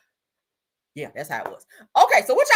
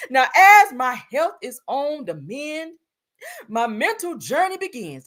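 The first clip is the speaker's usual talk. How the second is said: slow, swelling mock-poetic drama; 5 semitones higher, 4 dB louder, slower than usual. Reading a singer's post to viewers, hammed-up solemn drama. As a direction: stretch each phrase theatrically. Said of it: "I gotta read it in her voice".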